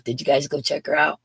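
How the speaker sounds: ordinary talk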